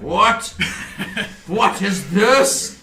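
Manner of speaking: high, loud laughing disbelief; startled villain. Acted. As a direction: sputter in shocked amusement. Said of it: "He's like".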